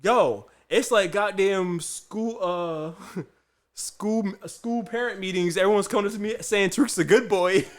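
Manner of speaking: high, loud retelling of dad; mock exclamation. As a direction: imitate an amused dad exclaiming, near laughter. Dad's amused group chat text read out.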